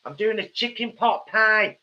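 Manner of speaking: put-on American accent. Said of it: "No American accent necessary".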